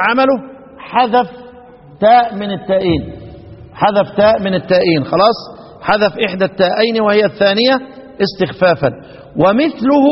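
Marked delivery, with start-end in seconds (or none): none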